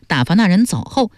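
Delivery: plain reading voice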